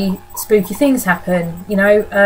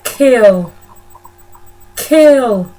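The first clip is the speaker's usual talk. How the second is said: put-on flat, loud, eerie child chant; hammed-up creepy child voice saying kill; creepy ghost kids; 3 semitones higher, 6 dB louder than usual. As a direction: intone it like a little ghost child. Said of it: "this is the only thing they say, they just go".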